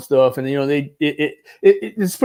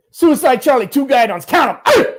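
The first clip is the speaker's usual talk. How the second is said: loud, high and proud unit call; proud Marine shouting his unit; 9 semitones higher, 5 dB louder than usual. Retold or imitated. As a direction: shout your unit with swagger.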